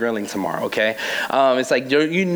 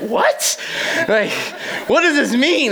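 bewildered laugh; 8 semitones higher than usual. Retold or imitated. Her bewildered reaction to Thomas scene.

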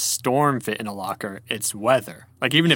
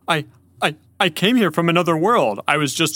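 lightly voiced nervous stammer, a touch higher; flustered Elias; 3 semitones higher, 3 dB louder than usual. A narrator's reading. Elias explaining himself to a giant lion, mildly flustered. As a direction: stumble into a hurried explanation.

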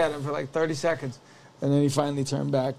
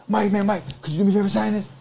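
imitating an eager fan, higher and louder; pushy, raised-voice mock fan request; excited fan; 4 semitones higher, 5 dB louder, faster than usual. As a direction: butt in like an excited autograph seeker. Excited fan interrupting, retold.

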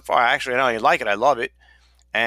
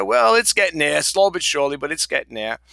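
higher, louder, cheerful reply; retold upbeat reply; 5 semitones higher, 3 dB louder than usual. Answering his nephew about channel growth, mildly upbeat. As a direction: perk up as if chatting with family. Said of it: "I said".

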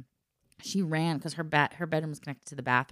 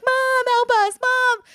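high, loud, panicky wail for mom; frightened calling; 10 dB louder, slower than usual. Retold or imitated. Two scared girls calling their mother, high panicked wail. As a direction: cry out for help like scared kids.

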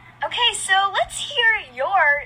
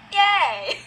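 usual speaking voice

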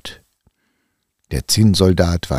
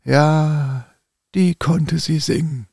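put-on old man; 11 semitones higher, slower than usual. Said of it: "sagte er".

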